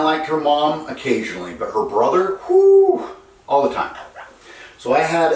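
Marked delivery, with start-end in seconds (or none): 2.5-3.1 whoo. Real, not acted. Reacting to the parrot and brother.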